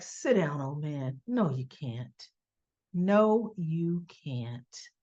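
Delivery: low, soft put-down; taunting. Retold or imitated. Marsha dismisses Sonny as an old man, low and taunting. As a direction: brush him off quietly and low.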